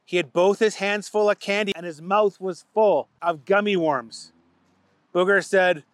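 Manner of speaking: ordinary delivery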